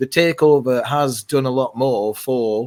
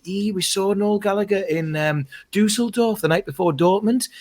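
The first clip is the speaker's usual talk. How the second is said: higher-pitched imitation of a chatty woman; lightly voiced woman; 9 semitones higher than usual. Woman telling him her news before the show, imitated.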